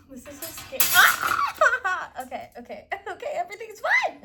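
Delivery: laughing, loud and high, talking fast through it; nervous laughter. Real, not acted. Reassuring herself through nervous laughs over a scary step.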